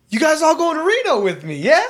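high, loud imitation of a drunk; drunk guy. Drunk traveler asks strangers about Reno, high mock-drunk holler. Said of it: "Guy was obviously intoxicated".

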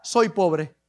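low, soft, deflated answer; small-voiced admission after a big promise; meek reply. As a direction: drop right down in pitch and volume. Acts Gedeón's meek reply.

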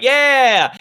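high, loud group cheer; crowd cheer. Acted. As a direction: cheer like a rowdy bar crowd.

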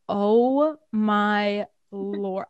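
drawn out very slowly in shock; stunned disbelief. Retold or imitated. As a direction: stretch each word, floored by what you heard. Stunned when mom swore.

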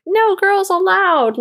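put-on high, slow bratty kid voice; mock second grader. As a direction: read the sign like a smug little boy. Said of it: "second grader was like".

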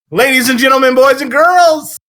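big announcer build to a sung shout; booming kids show opener. Acted. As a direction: hype the crowd like a ringmaster. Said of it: "That was pretty live".

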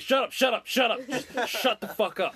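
mock freaked-out yell. Friend imitating Spanky panicking at his friends, yelling. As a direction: snap at everyone like you're tripping.

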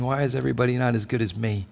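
a soft, quick, self-righteous grumble; a lightly voiced, muttering Pharisee; grumbling Pharisee. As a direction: grumble it under your breath like a smug onlooker. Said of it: "sitting outside grumbling".

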